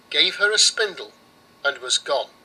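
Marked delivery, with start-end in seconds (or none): none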